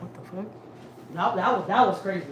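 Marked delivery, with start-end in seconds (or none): 0.0-0.4 a barely voiced, much softer gasp of disbelief; stunned quiet. Wife stunned by the stranger's remark, barely audible. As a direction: breathe it out, too shocked to speak up. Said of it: "I was like".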